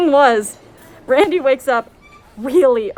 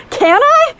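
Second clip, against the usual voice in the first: high-pitched, eager question; excited; 14 semitones higher, 4 dB louder than usual. Acted. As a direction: squeal it like a thrilled kid asking permission. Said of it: "So I'm all excited".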